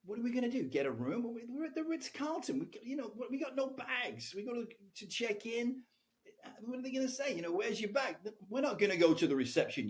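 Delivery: high, rising exasperated protest. Retold or imitated. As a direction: argue it like the plan is absurd. Exasperated arguing with Tamaz.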